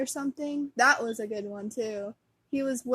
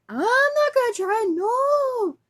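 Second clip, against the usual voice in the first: put-on very high, loud kid protest; acted stubborn refusal; 12 semitones higher, 7 dB louder than usual. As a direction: refuse like a little brother, drawn high. Boy refusing cereal in acted argument.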